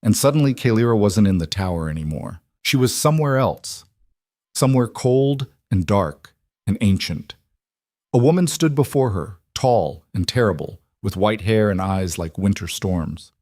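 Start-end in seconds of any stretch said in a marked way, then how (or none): none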